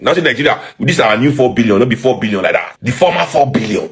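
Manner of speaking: ordinary talk, nothing marked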